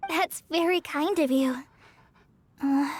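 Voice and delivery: ordinary talk, nothing marked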